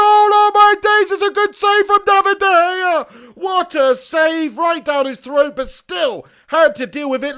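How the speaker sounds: shouted, high, drawn-out football commentary; loud live match excitement, stretching key words; excited play-by-play. Real, not acted. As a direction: roar the save as it happens.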